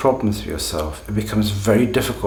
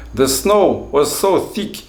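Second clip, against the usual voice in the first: put-on slow, higher Russian-accented English; 6 semitones higher, 4 dB louder, slower than usual. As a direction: play a Russian learner of English, pitched up. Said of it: "when you listen to Russian speakers speaking English".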